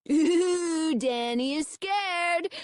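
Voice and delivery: high, drawn-out teasing sing-song; mocking taunt. A narrator's reading. Fred teasing Danny for being scared, mocking taunt. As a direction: taunt him like a schoolyard bully. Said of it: "Fred cried".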